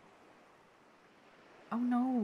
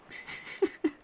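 helpless giggle; 4 dB louder than usual. Acted. Apologizing to a soaked friend, helplessly laughing.

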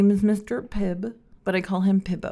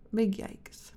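ordinary talk, nothing marked

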